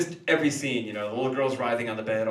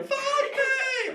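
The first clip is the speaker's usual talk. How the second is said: put-on screaming possessed girl.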